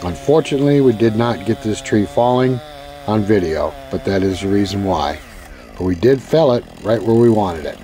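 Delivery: calm, low narration read; voiceover narration. Acted.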